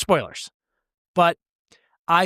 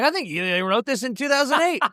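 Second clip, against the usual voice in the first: mock naive guess; 9 semitones higher than usual. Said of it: "We're like".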